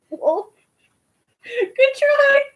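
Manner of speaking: high loud mock-cheery good try; teasing. Host teasing a friend about Africa, high and mocking. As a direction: tease the wrong guess.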